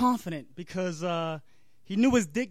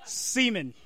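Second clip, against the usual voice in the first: ordinary talk, nothing marked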